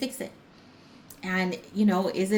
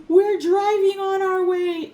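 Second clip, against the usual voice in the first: much higher, louder, slow, drawn-out put-on voice; mock mover voice; 13 semitones higher, 10 dB louder, slower than usual. Mover on the phone insisting they're coming, high slow put-on voice. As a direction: stretch it like a fibbing guy on the phone. Mover claims he's driving over.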